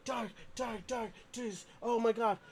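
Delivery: high, frantic friend's voice; high panicky pleading; panicked friend. Retold or imitated. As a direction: squeal it like a terrified buddy. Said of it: "he's like".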